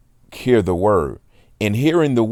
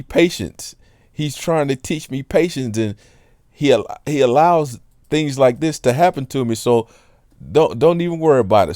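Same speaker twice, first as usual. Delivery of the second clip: higher, earnest lady's voice; imitating the lady; 6 semitones higher than usual. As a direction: play the lady explaining, with a lift in pitch. Quoting the lady's explanation.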